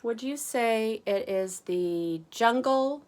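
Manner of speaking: ordinary delivery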